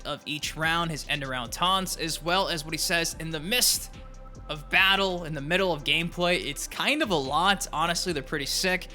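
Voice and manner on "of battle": ordinary talk, nothing marked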